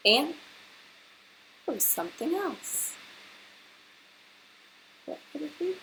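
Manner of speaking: rising, hushed mystery voice; suspenseful storytelling. A narrator's reading. Teacher teasing the children about the surprise, hushed. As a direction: lean in and tease what comes next.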